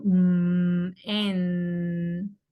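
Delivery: long hummed "Mm", then drawn-out "en"; held nasal consonants. Shown as a demonstration. Teacher showing voiced M and N, sustained hums. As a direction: hum "Mm" and hold the n of "en". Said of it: "como un sonido similar".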